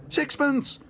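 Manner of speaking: very high, bright bid; eager bid. Acted. Bear raises his bid eagerly. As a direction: pipe up eagerly. Paddington bidding.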